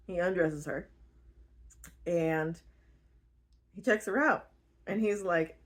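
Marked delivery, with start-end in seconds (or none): none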